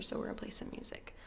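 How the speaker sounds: quick hushed whisper; whispered aside to chat. Real, not acted. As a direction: slip it out in a rushed whisper.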